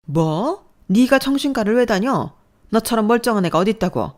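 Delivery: loud, disbelieving outcry; mother stunned by the news; shocked yell. Acted. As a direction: gasp and shout in disbelief. Mother shocked about psychiatry.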